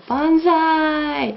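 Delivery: high, slow, drawn-out "banzai" cheer; stretched, joyful high-pitched banzai; happy cheer. Acted. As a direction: cheer it out like a delighted cartoon character. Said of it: "to show how happy he is".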